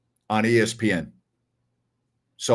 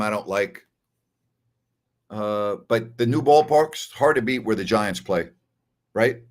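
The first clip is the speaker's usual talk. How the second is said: ordinary talk, nothing marked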